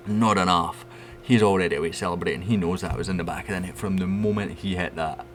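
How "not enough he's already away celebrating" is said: ordinary talk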